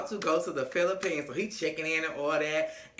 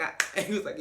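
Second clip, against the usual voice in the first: ordinary delivery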